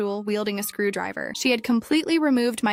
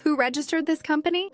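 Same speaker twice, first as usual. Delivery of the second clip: higher-pitched, urgent question; mildly alarmed, pitched-up demand; shocked question; 6 semitones higher than usual. A narrator's reading. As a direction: ask with rising disbelief. Shocked designer questions rival bid.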